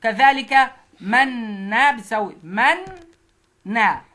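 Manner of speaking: slow, loud منا pulled apart; slow syllable split. Shown as a demonstration. Teacher slowly splitting منا for the class. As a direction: split منا into مَنْ then نَا. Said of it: "لما نفك التضعيف".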